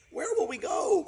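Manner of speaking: chorus of cygnets shouting; excited cry. Acted. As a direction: shout it out, eager children. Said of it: "cried all the cygnets".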